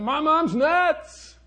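put-on high, loud, drawn-out kid's claim; the son's voice. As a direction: mimic the boy telling everyone. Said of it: "he was going around saying".

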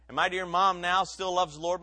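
ordinary talk

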